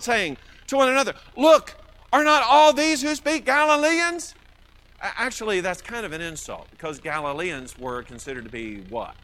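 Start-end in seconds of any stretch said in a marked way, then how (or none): 1.3-4.3 high, loud astonished question; amazed onlookers. Acted. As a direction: marvel out loud, pitched right up. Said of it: "they were all amazed and they marveled".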